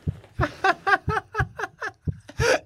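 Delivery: wild, high, loud laugh; manic laugh. Acted. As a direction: burst into unhinged laughter. Manic laugh while tripping.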